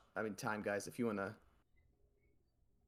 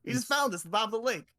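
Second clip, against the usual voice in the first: excited explaining; 6 semitones higher, 4 dB louder, faster than usual. Acted. Jerome shows off claw arm.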